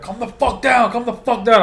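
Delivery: high, loud repeated shout; retold yelling. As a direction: re-enact yelling to get someone under control. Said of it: "I'm all yelling at him".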